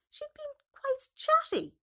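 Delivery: ordinary delivery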